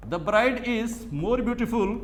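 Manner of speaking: stretched out word by word; slow dictation. Shown as a demonstration. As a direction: dictate for students to copy. Teacher dictating example sentence.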